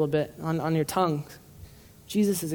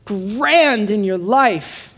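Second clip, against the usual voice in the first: grand stretched slowly and louder; stress on grand; 4 semitones higher, 5 dB louder, much slower than usual. Real, not acted. Preacher urges listeners to put God first, slow emphasis. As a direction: draw out grand in your life, slow and higher.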